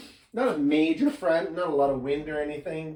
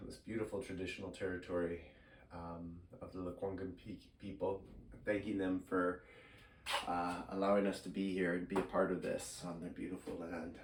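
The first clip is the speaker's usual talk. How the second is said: softer, solemn acknowledgement; 6 semitones lower, 12 dB quieter than usual. Acted. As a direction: say it softly, with respect.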